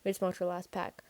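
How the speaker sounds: ordinary delivery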